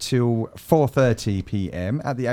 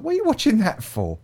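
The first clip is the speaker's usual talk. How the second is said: quick, high-pitched retold challenge; mock questioning; 9 semitones higher, faster than usual. Husband retelling his question to his wife, mock questioning. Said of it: "I was like".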